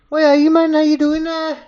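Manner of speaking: very high, loud mock Geordie; high-voiced Geordie. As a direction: push a Newcastle accent right up high. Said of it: "Why has he got such a high voice?".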